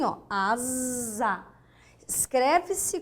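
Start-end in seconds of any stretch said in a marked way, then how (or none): 0.3-1.5 "asa" slowly, with a long buzzing z for the s; phonetic Z. Shown as a demonstration. Teacher showing it is pronounced Z, drawn-out buzz. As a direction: hold the voiced z inside "asa". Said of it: "para a palavra asa é assim".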